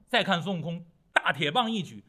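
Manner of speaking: ordinary delivery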